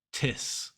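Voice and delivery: Tis with a puff of air on the T; aspirated T. Shown as a demonstration. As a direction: isolate "Tis", T not D. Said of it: "with a puff of air".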